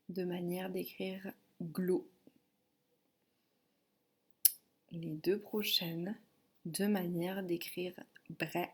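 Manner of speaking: slow, measured syllable dictation. Shown as a demonstration. Teacher dictating syllables for a pupil to write, slow dictation. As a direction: dictate slowly, leaving room before the target syllable. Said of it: "Je vais te dicter".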